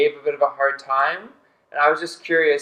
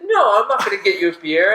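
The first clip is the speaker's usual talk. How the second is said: higher-pitched impression of a reluctant friend; Gabe; 4 semitones higher, 3 dB louder than usual. Storyteller mimics a friend refusing a beer run, higher put-on voice. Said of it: "Yeah, that's the Gabe voice".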